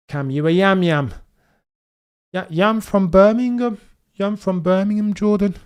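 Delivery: high-pitched mock Birmingham accent. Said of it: "I don't do the accent very well".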